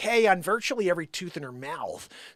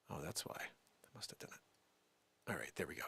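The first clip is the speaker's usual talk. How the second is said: whispered aside; whispering; 14 semitones lower, 21 dB quieter than usual. Real, not acted. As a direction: whisper it to yourself. Off-mic setup mutter.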